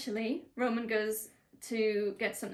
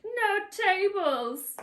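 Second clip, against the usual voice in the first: much higher, very slow hammed-up yell; mock shout; 11 semitones higher, 5 dB louder, much slower than usual. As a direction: stretch out a cartoonish no tables.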